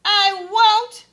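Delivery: high loud shout. Acted. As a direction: shout the refusal. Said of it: "shouted Kevin".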